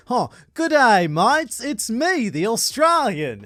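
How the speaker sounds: loud, high, hammed-up Aussie greeting. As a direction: play up a broad Australian accent. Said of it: "play the stereotype of".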